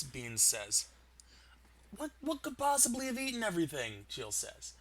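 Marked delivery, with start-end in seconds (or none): 1.9-4.1 much higher, louder puzzled voice; can't believe the question he's asking; baffled character voice. Acted.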